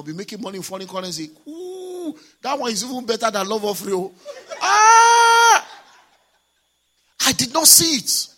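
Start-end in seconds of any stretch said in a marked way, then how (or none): 1.5-4.1 excited realization. Retold or imitated. Realizing profit opportunity. 4.6-5.7 a very high, loud cry of discovery; shout of realization. Retold or imitated. The pastor reliving the airport realization with a high, loud cry. 7.2-8.3 higher and louder in amazement; stunned exclamation. Retold or imitated. The pastor telling the congregation his blindness, higher and louder. After the realization cry.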